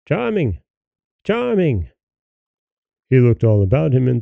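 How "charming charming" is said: high, drawn-out call from afar. A narrator's reading. Unseen voice calling Charming from the water. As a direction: call his name across the river bank. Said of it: "heard someone calling".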